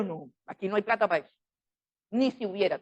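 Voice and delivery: ordinary talk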